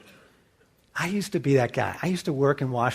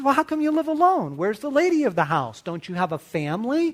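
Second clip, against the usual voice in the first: a lightly voiced boy, higher, prying; curious little boy; 7 semitones higher, faster than usual. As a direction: ask like an innocent nosy kid. Eight-year-old self questioning adult.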